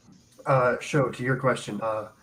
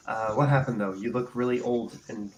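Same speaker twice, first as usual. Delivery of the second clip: ordinary delivery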